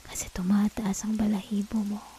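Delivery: soft intimate whisper. Acted. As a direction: murmur it right into the ear. Seductive whisper in roleplay.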